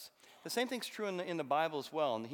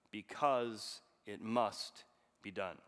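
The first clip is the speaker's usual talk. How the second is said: put-on slow, softer Russian-accented "because it must be done"; quiet Russian accent close; 4 semitones lower, much slower than usual. Officer ending his speech to the liquidators, slow quiet Russian accent. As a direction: land the last line slowly and lower in a Russian accent. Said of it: "a bad Russian accent".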